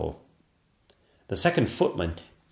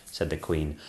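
usual speaking voice

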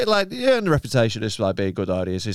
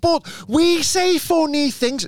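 high, slow Scouse whine; wounded local objecting in exaggerated Scouse; mock Scouse crowd; 10 semitones higher, slower than usual. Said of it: "And they're like".

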